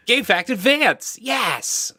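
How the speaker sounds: very high, hammed-up slow holler; terrible Ed impression. Host badly imitating absent co-host Ed, hammed-up high cheer. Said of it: "that was a really terrible Ed impression".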